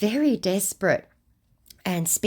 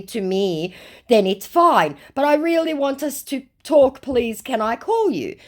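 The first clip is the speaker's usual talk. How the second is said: higher-pitched, wheedling request; hammed-up begging for a call; mock pleading scammer; 7 semitones higher, 5 dB louder than usual. Scammer begs to phone her, mock pleading. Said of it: "said our scammer".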